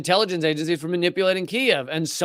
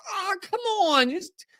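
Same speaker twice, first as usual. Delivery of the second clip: mock begging newspaper; 7 semitones higher, 5 dB quieter than usual. Mocking New York Times coverage begging for a bone, soft whine. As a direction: whimper for a scrap of attention. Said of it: "coverage nowadays is just".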